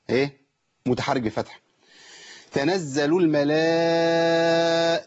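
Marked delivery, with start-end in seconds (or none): 2.5-5.1 slow chant, very long aa on "الملا"; connected madd. Shown as a demonstration. Teacher showing connected madd, "الملا" held very long. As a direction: hold the end of "الملا" steady and long. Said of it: "ده مد إيه بقى".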